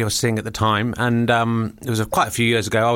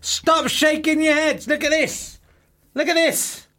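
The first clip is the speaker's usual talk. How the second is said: high, insistent protest; indignant; 13 semitones higher, slower than usual. Real, not acted. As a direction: insist they look, pitched up high. Defending sushi, showing weight loss.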